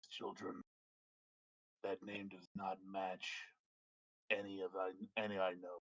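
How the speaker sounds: low, soft ancient dragon; deep quiet dragon musing. Acted.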